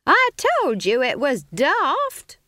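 high, drawn-out scolding shout. A narrator's reading. Witch scolds her friend mid-flight, shouted. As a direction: shout it high, stretching each word. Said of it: "she shouted".

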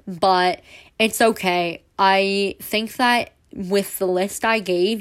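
loud, too close to the mic; nearly yelling into the mic. Real, not acted. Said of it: "I'm sorry for yelling into the mic again".

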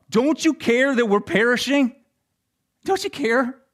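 high, urgent, frightened cry; panicked plea. Acted. Disciples begging the sleeping Jesus, frightened and high. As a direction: plead in panic as the boat sinks.

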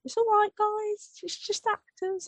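high-pitched imitation of a kid; reassuring boy. Imitating Noah calming scared adults, high and reassuring. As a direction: pipe up, high, to calm everyone.

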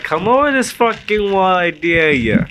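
high, slow, drawn-out taunt; famous video impression. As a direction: imitate a viral clip's goading dare. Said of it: "that famous video is like".

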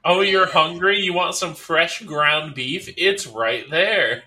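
playful high offer, slow; mock sweet host.

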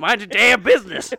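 high, loud Southern retort; indignant mock shout. Boy answering the nosy old man, indignant shout. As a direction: shout back in mock outrage.

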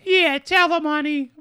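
high, loud mock wife voice; imitating the wife. Wife egging on her complaining husband, high and loud. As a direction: squeal it like the cheering wife.

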